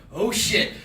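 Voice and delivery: acted-out sudden alarm; alarmed reaction. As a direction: exclaim it as trouble dawns. Acts out his sudden panic.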